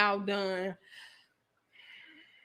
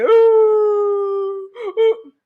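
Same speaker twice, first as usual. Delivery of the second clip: hammed-up fake sobbing wail, higher and louder; mock crying; 8 semitones higher, 6 dB louder than usual. Shown as a demonstration. Showing viewers how the selfie crier sobs, mock wail. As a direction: fake a big crying ooh. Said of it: "so I'm crying like this".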